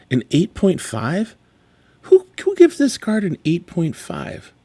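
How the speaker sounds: disbelieving high rising question; incredulous. Real, not acted. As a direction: sound baffled by the score. Incredulous at grade.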